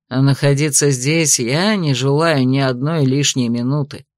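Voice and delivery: a hint of a stern investigator, low. A narrator's reading. Disgusted investigator speaks.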